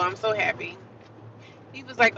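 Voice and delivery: usual speaking voice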